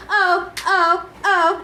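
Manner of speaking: high sung robot moans; robotic moans. Acted. The console moans as the cords touch her, robotic. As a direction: moan like a machine in bliss. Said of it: "listening to her robotic moans".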